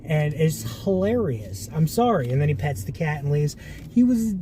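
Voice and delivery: ordinary delivery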